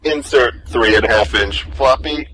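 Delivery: low, flat machine voice; robot voice. Acted. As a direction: announce it like a computer prompt. Robot speaks to master.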